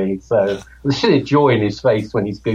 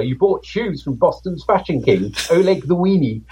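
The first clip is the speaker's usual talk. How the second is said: higher, slower teasing quote; amused mocking quote; 7 semitones higher than usual. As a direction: quote the jab, amused and drawn out. Quoting Carla's mocking line.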